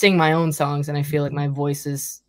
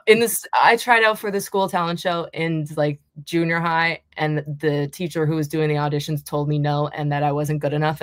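ordinary delivery

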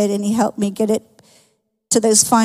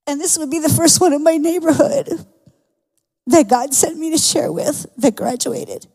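real tearful, breaking voice; choked up; 2 semitones higher than usual. Real, not acted. Woman telling her church her neighbor has died, choked up. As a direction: fight back tears while testifying. Moved to tears telling of death.

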